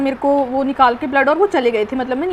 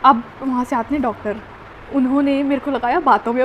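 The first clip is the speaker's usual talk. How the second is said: ordinary talk, nothing marked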